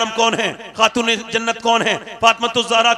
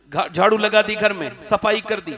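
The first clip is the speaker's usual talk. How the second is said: normal talking voice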